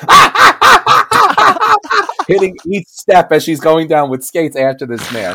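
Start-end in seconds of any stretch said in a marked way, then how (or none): 0.1-2.1 very high, repeated squeals; bumping yelps. Retold or imitated. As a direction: squeal on every bump down the steps.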